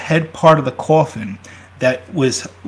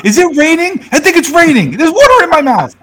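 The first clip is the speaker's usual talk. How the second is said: much higher, louder mock panic; Monty Python style hammed-up panic; freaking-out corpse; 12 semitones higher, 6 dB louder than usual. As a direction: squeal it like a buried man losing it. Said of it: "He's starting to freak".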